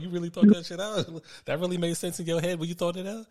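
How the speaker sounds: high, loud disbelief through laughter; incredulous mockery. Real, not acted. Mocking a criminal's foolish plan.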